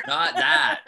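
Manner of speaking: laughing refusal. Pretend.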